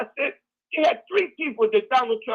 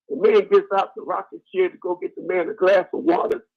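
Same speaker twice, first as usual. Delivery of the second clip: normal talking voice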